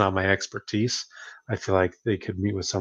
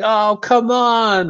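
high, slow, whiny oh come on; mock disappointed plea; 14 semitones higher, 7 dB louder, much slower than usual. Friend pleading after being turned down, mock whiny. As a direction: drag it out like a let-down friend. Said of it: "They might be like".

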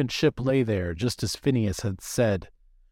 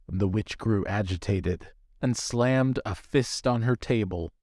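ordinary delivery, nothing marked

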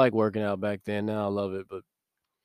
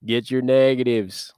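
slow, louder Southern drawl of a gym coach; Southern coach accent; 2 semitones higher, 4 dB louder than usual. Retold or imitated. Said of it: "real deep Southern accent".

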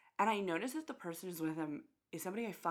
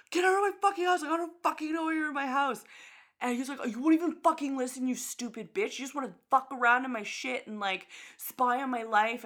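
higher, louder, fast mock yelling; mock angry yelling; 7 semitones higher, 8 dB louder than usual. Imitating the guy yelling at her, mock angry. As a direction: shout him down like the drunk guy. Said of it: "he was like".

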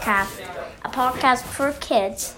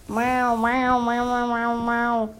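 string of playful cat meows; slower than usual. Acted. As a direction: meow like a cartoon cat. Cat character meowing in story.

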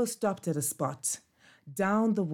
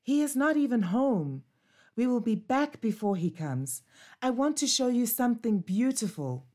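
put-on higher, eager young puppy voice; a restless pup longing to roam; eager puppy Bobo; 7 semitones higher than usual. Bobo insists to Ninja.

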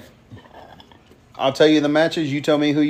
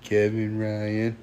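quiet; 3 semitones lower, 7 dB quieter, much slower than usual. Retold or imitated. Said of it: "she said".

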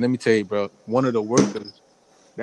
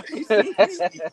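laughing; 11 semitones higher, slower than usual. Real, not acted. Laughing while retelling.

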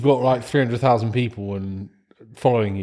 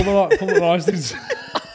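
higher-pitched, laughing disbelief; 8 semitones higher than usual. Real, not acted. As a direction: crack up while echoing a friend's words. Cracking up at friend's slip.

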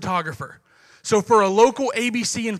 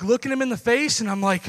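normal talking voice

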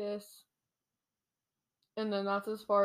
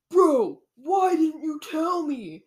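high, loud, drawn-out complaint; hammed-up hurt protest; mock indignant; 7 semitones higher, 8 dB louder, slower than usual. Retold guard confronts his ex.

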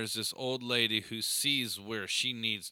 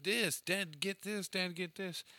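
put-on high little-kid begging voice; toddler pleading; 8 semitones higher than usual. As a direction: ask dad like an eager two-year-old. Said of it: "she's like".

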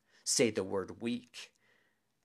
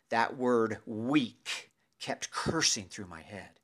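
usual speaking voice

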